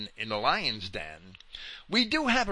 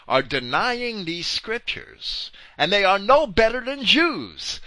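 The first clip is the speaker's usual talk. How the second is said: raised, emphatic pulpit denunciation; forceful preaching; 5 semitones higher, 5 dB louder than usual. Real, not acted. Preacher denouncing those who deny the scriptures, forceful. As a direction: preach it hard, pitch lifted and stresses punched.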